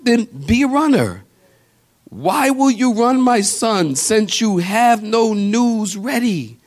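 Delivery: high, ringing preacher's climax; fervent pulpit exhortation; preaching at full force. Acted. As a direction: drive the point home from the pulpit.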